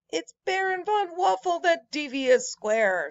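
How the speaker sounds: a touch of storybook anger at the villain; angry accusation. Acted. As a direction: accuse him with mild heat. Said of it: "said Pancake with anger".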